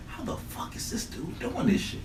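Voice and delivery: stunned whisper; whispered disbelief. Retold or imitated. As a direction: whisper it in amazement. Friend voiced in retold story.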